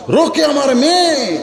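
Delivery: high, drawn-out proclamation; emphatic declaration. Acted. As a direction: raise the pitch and stretch মেয়ে.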